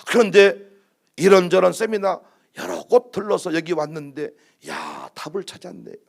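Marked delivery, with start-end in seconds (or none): none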